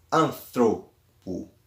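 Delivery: anthropo in very slow syllables, THRO stressed. Shown as a demonstration. Teacher showing the accented syllable, anthropo slowly stressed on THRO. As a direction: break up anthropo and weight the THRO. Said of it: "thro, na sílaba thro".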